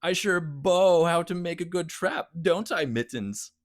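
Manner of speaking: high, slow gnome voice; gloating gnome. Acted. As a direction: gloat slowly like a trickster gnome. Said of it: "the gnome gloated".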